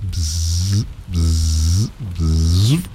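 buzzing bee imitation. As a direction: buzz as if a bee flew. Imitating a bee for Miss Lowe.

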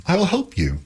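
high-pitched keen offer; eager cry. A narrator's reading. Epimetheus running over to help, crying out keenly. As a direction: pipe up to help. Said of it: "cried Epimetheus".

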